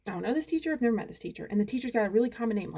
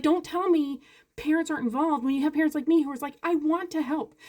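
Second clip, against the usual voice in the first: higher, emphatic appeal; exasperated plea; 6 semitones higher, 4 dB louder than usual. Real, not acted. Parent insisting on being allowed to help, exasperated. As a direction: push each word with frustration, ending louder. Frustrated parent pleading to be let in.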